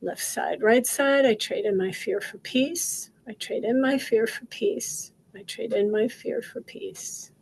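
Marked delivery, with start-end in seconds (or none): 1.3-5.1 steady, rhythmic repeated affirmation; guided affirmation. Acted. Coach leading viewers through tapping, calm chant. 5.3-7.3 whispered affirmation. Acted. Coach tapping with viewers, whispered affirmation.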